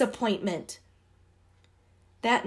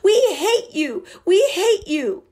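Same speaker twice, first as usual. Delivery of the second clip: put-on high, loud screaming girl; 10 semitones higher, 7 dB louder than usual. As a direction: scream hatred at the bullies. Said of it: "Debbie screamed".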